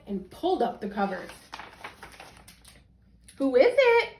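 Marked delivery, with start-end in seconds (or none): 3.4-4.1 wolf in a higher, louder sugary granny voice; the disguised wolf calls to the girl in a sweet granny voice; sweetest little Granny voice. Acted. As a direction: sweet-talk as a fake granny. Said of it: "in his sweetest little Granny voice".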